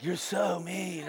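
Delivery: soft, drawn-out mock reproach; hushed, stretched-out mock hurt; mimicked dog people. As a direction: scold very slowly in a small, wounded voice.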